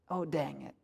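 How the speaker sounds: quiet, low, rueful; dismayed low mutter. Retold or imitated. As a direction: mutter it under your breath. Recurring dismayed refrain.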